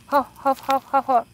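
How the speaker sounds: loud puffed huff huff animal noise; imitated huffing. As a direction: mimic the creature's huffing breaths. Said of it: "I just heard".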